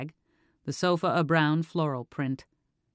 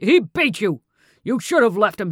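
much louder and higher furious shout; angry shout; 5 semitones higher, 9 dB louder than usual. A narrator's reading. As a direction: shout it at the daughter in rage. Father's angry outburst at daughter.

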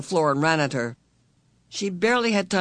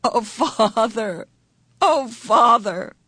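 slow, higher, voice breaking with joy; happy sob; 6 semitones higher, 4 dB louder, much slower than usual. A narrator's reading. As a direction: let the voice break on happy tears. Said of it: "Her voice broke on a happy sob".

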